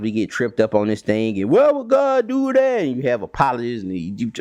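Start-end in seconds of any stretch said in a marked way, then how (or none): 1.5-2.8 high, put-on skeptic voice; mock doubter. Skeptic mocking God's justice, high put-on voice. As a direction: play the puzzled doubter, pitched way up.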